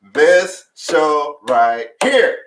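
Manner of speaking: slow, loud, high-pitched hype; retold big endorsement, word by word; emphatic proclamation. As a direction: drive home each word slowly, rising to the end. Quotes own emphatic verdict on show.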